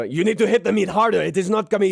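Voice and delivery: high, loud chef scolding a cook; angry chef. Acted. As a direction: bark at the cook like a furious head chef. GM voices angry head chef.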